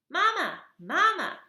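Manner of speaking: tiny high squeaky chick voice; high peeping baby bird; baby gosling peep. Acted.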